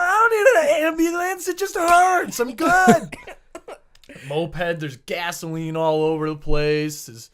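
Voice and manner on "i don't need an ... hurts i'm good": high, loud wailing protest; frantic refusal. Retold or imitated. Rider refusing the lady's ambulance, high shouted protest. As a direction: yell back in a high, hurt voice.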